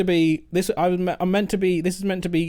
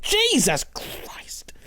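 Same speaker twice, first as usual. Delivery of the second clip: whispered; much slower than usual. Real, not acted. Startled aside during game.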